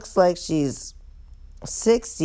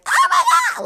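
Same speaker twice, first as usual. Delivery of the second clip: sudden piercing shriek; screaming greeting; 4 dB louder, much faster than usual. Acted. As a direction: scream it at the top of your range. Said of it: "scream and yell. Everyone does it".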